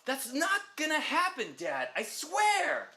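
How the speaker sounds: put-on teen son. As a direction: swear it to Dad like a kid. Son promises his dad.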